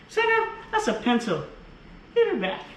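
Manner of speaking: very high, sharp scolding; snatch the pencil back with a high sharp voice. Real, not acted. Parent telling a child to give the pencil back, high sharp scold.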